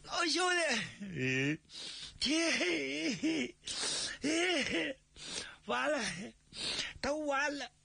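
high, broken lament with pauses; aggrieved, near tears. Acted. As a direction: let it all fall apart, choking on the words. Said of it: "觉着委屈". (委屈哽咽)

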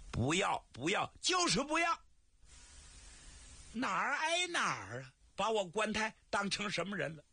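high, rising protest, refusing flatly; indignant refusal. Acted. Guan Tai rejects the match offer, indignant high protest. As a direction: push the offer away, offended.

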